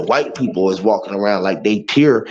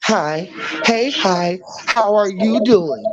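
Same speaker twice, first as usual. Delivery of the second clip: much higher, sing-song phony hello; mock fake greeting; 9 semitones higher, slower than usual. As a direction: overdo the fake sweetness. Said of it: "Yeah, hello".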